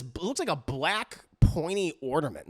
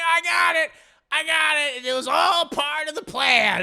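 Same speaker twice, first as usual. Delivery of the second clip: higher, louder cocky call; confident shout; 9 semitones higher, 5 dB louder than usual. Retold or imitated. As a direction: shout it like it was all planned. Mommy reassures mid-fight.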